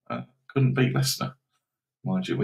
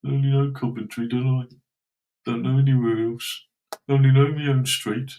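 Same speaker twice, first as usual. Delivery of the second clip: lightly voiced teasing whine of a local who knows nothing else; mocking a Midlands viewer; 4 semitones higher, 4 dB louder than usual. Streamer teasing Midlands viewers, mild mocking whine. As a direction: mildly take off a small-town fan, sing-song and teasing. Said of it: "typical Middle-earth".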